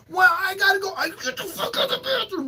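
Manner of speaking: very high, hammed-up squawking; frantic bathroom argument. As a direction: act out a desperate high-pitched squabble. Acting bathroom argument with daughter.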